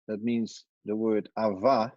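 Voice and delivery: normal talking voice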